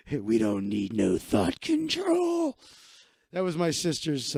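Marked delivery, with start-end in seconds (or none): 0.1-2.6 lower, hammed-up lyric recital; dramatic performance. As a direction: belt it like a schoolkid actor. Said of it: "I want you to perform it when you do it".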